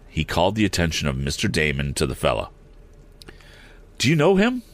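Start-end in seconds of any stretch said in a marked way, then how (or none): 4.0-4.6 eager, high-pitched question. A narrator's reading. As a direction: ask eagerly in a much higher voice. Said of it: "asked Tom eagerly".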